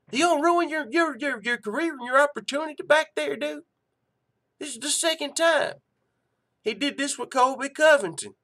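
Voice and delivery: loud high heated rant. Real, not acted. Host ranting at Usman's backstage antics, loud and heated. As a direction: rail at him, voice up high.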